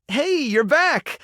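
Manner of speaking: high, drawn-out happy welcome; delighted greeting. Acted. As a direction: greet them with a delighted lift. Greeting a returning helper.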